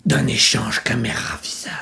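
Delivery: low, unvoiced whisper; whispered aside. Explaining himself to the camera, whispered aside.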